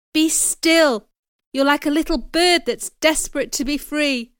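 emphatic, heated plea; urgent command. A narrator's reading. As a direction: urge her to calm down. Urging a struggling woman.

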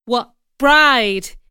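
loud, puzzled retort; loudly incredulous; baffled question. A narrator's reading. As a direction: demand to know, taken aback. Startled reply in acted quarrel.

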